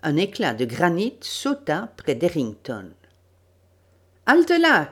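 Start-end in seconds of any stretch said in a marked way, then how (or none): 4.2-4.9 loud, high-pitched shout; shouted challenge. Acted. Gunman halts the approaching group, shouting. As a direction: bellow a halt at intruders. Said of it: "cria le tireur".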